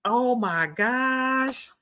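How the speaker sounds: drawn-out, high mock whine; slow tearful moan, put on; crying retold. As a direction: stretch it out like a weepy complaint. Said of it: "I'm crying my eyes out".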